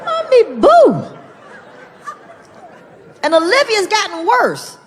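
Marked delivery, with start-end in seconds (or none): 0.1-0.9 mock outrage. Father outraged at his kids calling him Mommy Boo. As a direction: blow up at the babies, half laughing.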